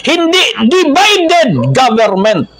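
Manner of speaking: high, drawn-out stress on "divided"; emphatic slogan contrast. Shown as a demonstration. Preacher rejects the wrong slogan, stressing "divided" high and slow. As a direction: stretch "divided" high, then drop on "government". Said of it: "Yung dapat isigaw".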